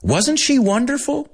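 high, eager gushing question. Acted. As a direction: fish for praise, pitch high. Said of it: "Diane turns to the doctor and says".

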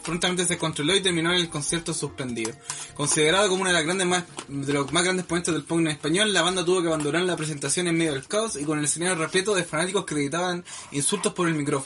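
steady newsreader delivery over music; news read. Acted. Reads a news item.

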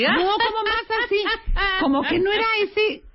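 put-on high-pitched, laughing her old laugh; high giggly voice. Shown as a demonstration. Showing the co-hosts her old laugh, high and giggly. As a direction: squeak it up like a girl. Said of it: "¿cómo te reías así?".

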